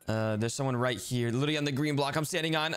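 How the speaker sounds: ordinary talk, nothing marked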